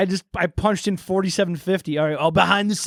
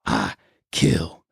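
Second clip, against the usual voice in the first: much lower with a pause; low halting deadpan; 4 dB quieter, slower than usual. Acted. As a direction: break it up, low and cold. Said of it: "Hold on".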